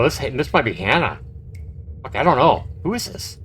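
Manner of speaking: ordinary talk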